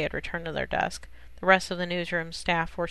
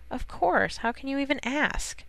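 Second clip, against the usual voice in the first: higher, offended retort; mild indignation at the question; indignant; 7 semitones higher than usual. A narrator's reading. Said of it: "Lois was indignant".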